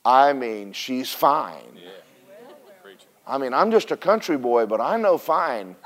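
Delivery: lightly voiced smitten young David, low and soft; dreamy country boy. David brags to the camp about the king's daughter, low and hushed. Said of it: "He's going".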